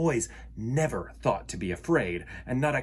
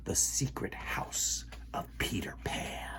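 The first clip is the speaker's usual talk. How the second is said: hushed, breathy reveal; secret whisper; 3 semitones lower, 11 dB quieter than usual. A narrator's reading. Storyteller reveals Peter's hideout, whispering. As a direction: let the children in on a secret.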